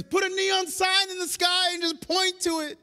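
high, shouted demand; loud prayer. Acted. Believer begging God for a sign in the sky, loud prayer. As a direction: shout it up high, exasperated plea. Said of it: "whoever prayed this".

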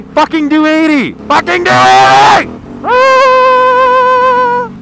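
loud high yell at other drivers; frustrated yell. Real, not acted. Ranting at drivers who won't do eighty, loud yell. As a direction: blow up at the traffic.

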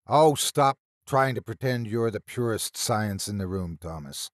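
ordinary delivery

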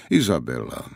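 low, grave naming of Izabela; deep voice. A narrator's reading. The lord introduces his cousin to guests, in a deep voice. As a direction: drop the voice low for the name. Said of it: "pravil Komont hlubokým hlasem".

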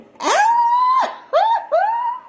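loud, very high delighted "ooh"; high-pitched whooping laugh; high squeal. Real, not acted. Own whoop after imitation.

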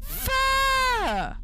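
long, high, loud curse; startled shout. Real, not acted. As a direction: yell it out, stretched, after a scare. Shouts after a jump scare.

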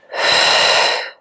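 long, audible breath out through pursed lips; mouth exhale. Shown as a demonstration. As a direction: blow the air out steadily. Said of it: "exhale out through the mouth with pursed lips".

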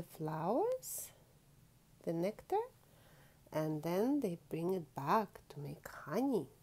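hushed storytelling whisper; soft whispered aside. Acted. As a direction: whisper it like a fun secret.

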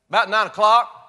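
loud answer from the hard-of-hearing grandfather; grandpa's loud answer. Retold or imitated. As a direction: answer loud like a deaf old man. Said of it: "he'd say".

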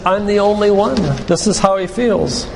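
ordinary talk, nothing marked

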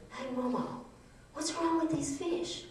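put-on high, soft little-boy voice; six-year-old grandson. Grandson in the stream asking about dead fish, high child voice. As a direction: imitate a small child asking, pitched well up. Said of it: "and he said".

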